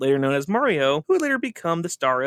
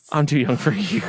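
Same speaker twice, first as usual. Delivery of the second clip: quoting a sitcom line. Retold or imitated. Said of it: "I'm referring to the It's Always Sunny".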